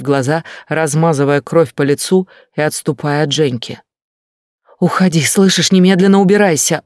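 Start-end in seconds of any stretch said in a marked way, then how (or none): 4.8-6.8 loud, high yell to leave; shouting. Acted. Lena shouting at the young man to get out, loud. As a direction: drive him off with a raised cry. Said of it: "ору я".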